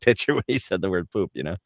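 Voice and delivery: usual speaking voice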